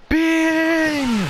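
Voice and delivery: high, loud drawn-out battle cry; a held cartoon battle yell; attack shout. Firing a named attack.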